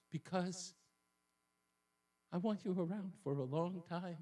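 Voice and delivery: soft, halting imitation of a pleading wife; mock-tearful sigh, slowed by a long pause; half cry, half sigh. Husband impersonates his wife guilt-tripping him, half crying. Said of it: "with half of a cry and half of a sigh".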